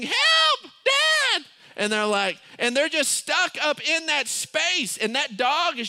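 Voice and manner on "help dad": high, loud mock scream; screaming for help. Kids cornered by the dog yell for Dad, screaming. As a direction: shriek it like cornered children calling out. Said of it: "they're just screaming".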